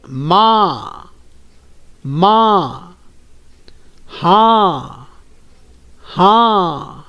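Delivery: माँ and हाँ drawn out, the aa fully nasalised; nasal vowel demo. Shown as a demonstration. Teacher modelling chandrabindu words, slow nasal vowel. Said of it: "उच्चारण कीजिए".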